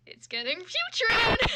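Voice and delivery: high, loud, slow cry of disgust; disgusted exclamation. Real, not acted. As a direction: howl it out in mock horror. Disgusted reaction to fanfic line.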